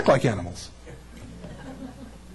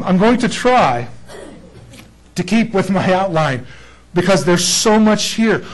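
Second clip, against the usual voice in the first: ordinary talk, nothing marked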